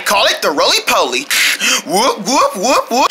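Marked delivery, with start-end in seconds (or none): none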